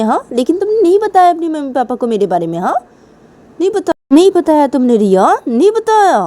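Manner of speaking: loud, high scolding; acted outburst at a partner who let her down; angry accusation. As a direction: hurl the accusation, rising in pitch. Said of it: "मैं तुम्हारे पर गुस्सा कर रही हूँ".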